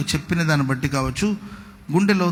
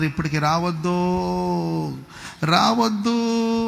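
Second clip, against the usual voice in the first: mock pleading warning; slower than usual. Preacher voicing emigrants warning those back home, long drawn-out plea. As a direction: imitate someone begging, stretching the last word long.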